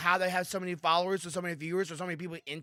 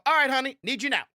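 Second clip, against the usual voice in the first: calling out; 7 semitones higher, 6 dB louder, faster than usual. Pretend. Calling wife to record.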